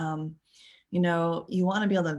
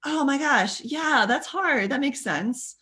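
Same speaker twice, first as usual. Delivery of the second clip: higher, slow, empathetic oh my gosh; sympathetic friend; 8 semitones higher, slower than usual. Retold or imitated. Kind friend soothing an upset caller, warmly sympathetic. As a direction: sound fully on their side. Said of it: "the friend who's saying".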